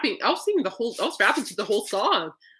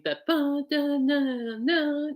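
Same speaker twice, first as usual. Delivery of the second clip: higher, hummed ba da na riff; la-la the guitar opening; quick vocal guitar line; riff imitation; 6 semitones higher, much slower than usual. Shown as a demonstration. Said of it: "especially with the opening".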